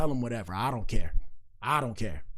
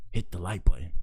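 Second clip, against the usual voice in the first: whispered plea to hit the like button; 6 semitones lower than usual. Shown as a demonstration. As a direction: drop to a breathy whisper for the ask. Repeating the like request.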